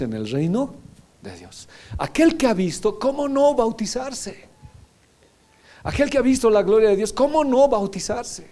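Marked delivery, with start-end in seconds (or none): 2.0-8.5 high, rising preacher's exhortation; impassioned pulpit call to enter; fervent preaching. Acted. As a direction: urge the congregation on, pitch lifted. Preacher urging baptism.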